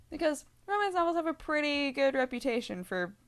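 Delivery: usual speaking voice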